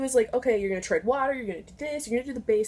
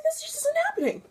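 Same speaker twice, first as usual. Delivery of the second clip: high despairing wail. Retold or imitated. Despairing thought.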